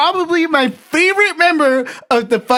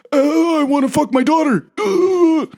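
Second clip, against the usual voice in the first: president impression; 6 semitones higher than usual. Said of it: "quote one of the old presidents".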